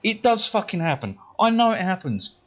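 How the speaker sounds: high-pitched, indignant contradiction; heated insistence. Retold or imitated. Pupil contradicting his teacher in a row, heated. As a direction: insist hotly, retelling the row.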